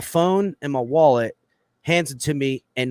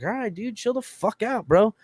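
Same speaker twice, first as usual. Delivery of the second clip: placating protest; 10 semitones higher than usual. Retold or imitated. Man tells screaming guard to calm down, placating protest. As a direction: act out telling someone to back off.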